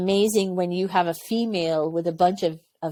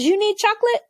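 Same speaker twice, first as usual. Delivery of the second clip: mock surprised, pitch shooting much higher; crew chief quote; 14 semitones higher, 3 dB louder, faster than usual. Crew chief surprised she wants chocolate, squeaky high. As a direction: squeak it out in disbelief. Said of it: "He goes".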